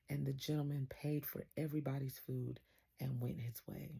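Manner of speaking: usual speaking voice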